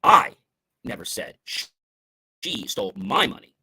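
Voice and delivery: I punched hard and loud, then a break; stress on I. Shown as a demonstration. Speaker showing how emphasis shifts meaning, stress on I. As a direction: hit the word I and set it apart. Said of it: "when we change the emphasis".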